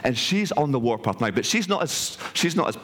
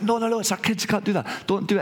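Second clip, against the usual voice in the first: high, urgent mock plea; retold pleading; 9 semitones higher, faster than usual. Mother goddess begs lover to spare their kids, retold pleading. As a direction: act out a mother begging him to stop.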